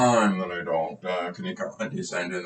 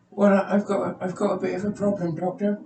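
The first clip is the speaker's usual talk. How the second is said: much higher, put-on voice of a timid patient; 10 semitones higher than usual. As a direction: play the nervous patient, pitched well up. Patient explains problem.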